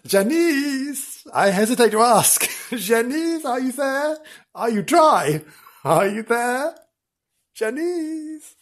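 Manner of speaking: high, tentative sing-out to someone far off; high-pitched, drawn-out hailing; calling out. Acted. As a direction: call for a colleague who may not answer. Calling out to field reporter.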